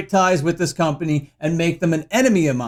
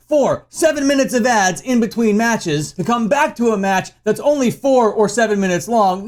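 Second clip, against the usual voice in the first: usual speaking voice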